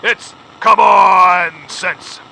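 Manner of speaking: very slow, loud, stretched punchline; drawn-out punchline. Acted. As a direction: stretch out every word of the punchline.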